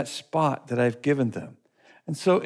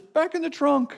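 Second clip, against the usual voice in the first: playful mock order; 12 semitones higher than usual. Driver ordering monkey, laughing.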